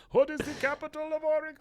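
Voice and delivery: very high, fast hammed-up yell; falling yell. Eduardo shouts while falling off cliff, absurd high yell. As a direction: shriek it as if plummeting. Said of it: "As he's falling".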